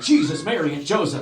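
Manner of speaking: loud, high outburst; startled exclamation. Retold or imitated. Driver going over a big bump, loudly exclaiming. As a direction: cry it out like your startled father.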